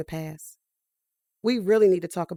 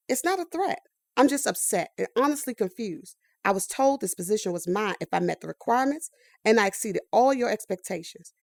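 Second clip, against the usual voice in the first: ordinary delivery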